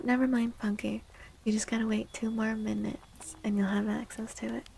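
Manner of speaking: normal talking voice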